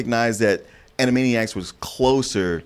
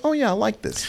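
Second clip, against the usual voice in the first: high, pleasantly surprised; 12 semitones higher than usual. Retold or imitated. Quoting own pleased reaction.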